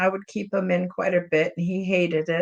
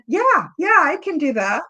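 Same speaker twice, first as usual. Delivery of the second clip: eager, high-pitched voice for a pet; cat's imagined reply; 8 semitones higher, 4 dB louder than usual. Retold or imitated. Owner voices her cat agreeing to check in, high and eager. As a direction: pipe up high and a bit louder as the cat. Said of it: "the feeling I got was he said".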